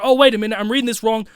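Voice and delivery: ordinary talk, nothing marked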